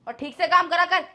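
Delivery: loud, snapping order; annoyed command while paying; curt angry. Acted. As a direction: shove the money at him and snap. Handing money angrily.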